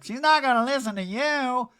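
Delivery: high put-on warning voice; Erica. Erica stopping Dorit from following, mock insistent. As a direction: imitate a housewife objecting. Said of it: "And Erica's like".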